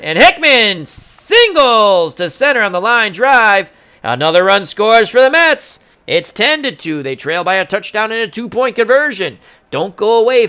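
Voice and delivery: high, loud, rising home-team excitement; excited play-by-play call. Pretend. Announcer calls a Mets run scoring, excited and loud. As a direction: belt out the hit as it happens.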